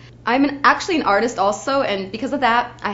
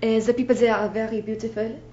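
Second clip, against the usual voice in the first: French accent; 3 semitones higher than usual. Shown as a demonstration. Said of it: "I can do a French accent pretty good".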